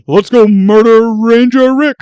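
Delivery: loud cartoon bear voice; goofy cartoon bear; Yogi Bear voice.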